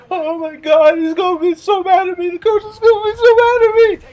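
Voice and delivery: high, loud, panicky wail; imitating a crying, scared friend; mock hyperventilating panic; panicked crying kid. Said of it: "Because he's like".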